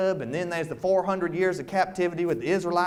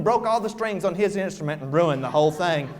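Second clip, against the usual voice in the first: plain reading voice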